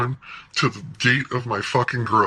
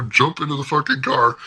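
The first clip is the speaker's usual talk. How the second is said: usual speaking voice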